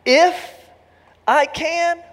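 hammed-up disbelief; mock incredulity. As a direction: stammer it back as if you can't believe it. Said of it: "and you're saying".

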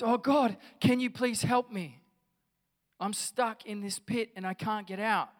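high, loud plea from a pit; pleading for help. Acted. Trapped man begs God for help.

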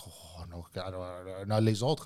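very soft, low mumbled stammer; mocking flustered boss. Lady boss stammering about the others, soft mock mumbling. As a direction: trail through her objection in a muffled murmur. Mimics boss's flustered reply.

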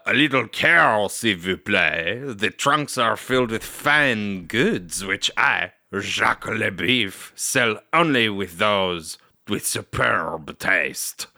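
slow, hammy fake French accent; overdone French accent, drawn out; bad French accent. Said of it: "terrible impressions of what he sounds like trying to do a French accent".

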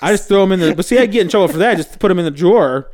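ordinary talk, nothing marked

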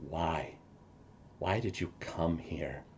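a hurt son asking his father, hushed; tearful. Acted. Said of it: "Tears start streaming down his face".

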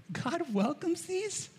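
high, slow astonished question; amazed disbelief. Retold or imitated. Psalmist wondering at the sparrows, high and amazed. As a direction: marvel that God lets them in. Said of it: "the psalmist is going".

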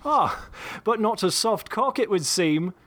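put-on high, slow British-accented drawl through a laugh; a British-accented gambler mocking the sheriff; English Bob, guffawing. A narrator's reading. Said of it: "replied English Bob with another guffaw".

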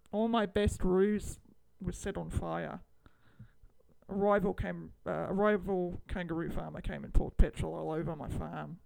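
deliberate, halting Australian storyteller; Australian accent. Acted. Kangaroo farmer mourning the best roos, in an Australian accent. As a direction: recount it earnestly in put-on Australian. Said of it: "Now we're back to the Australian accent".

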